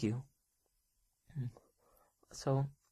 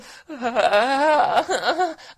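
scared laugh; 7 semitones higher, 14 dB louder than usual. Acted.

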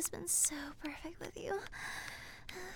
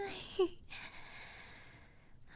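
breathy giggle; 2 semitones higher than usual. Pretend. Afterglow giggle.

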